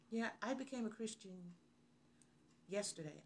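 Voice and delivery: soft, hesitant admission; timid confession. Acted. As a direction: admit it meekly, with a halting pause. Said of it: "Why don't we have that confidence?".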